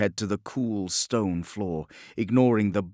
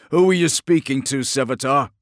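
louder, hard interrogation; stern guard voice; 5 semitones higher, 7 dB louder than usual. A narrator's reading. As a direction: question him coldly. Captor speaks.

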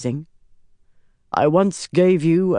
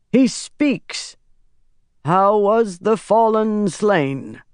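raised pitch, measured declaiming; reciting prophecy; 5 semitones higher, 4 dB louder than usual. A narrator's reading. Pytar quotes the ancestral prophecy to Rand, solemn recitation. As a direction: intone it in weighty, separate phrases. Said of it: "Pytar quoted".

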